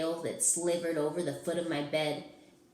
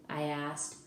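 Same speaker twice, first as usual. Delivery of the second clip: usual speaking voice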